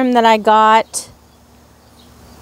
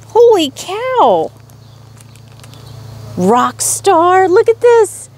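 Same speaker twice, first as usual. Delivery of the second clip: excited squeal; 9 semitones higher than usual. Real, not acted. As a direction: gasp it out, thrilled. Unboxing mystery bag.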